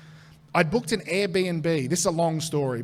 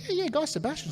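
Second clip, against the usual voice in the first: eager bartender; 10 semitones higher, 3 dB quieter, faster than usual. Retold or imitated. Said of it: "And the guy's like".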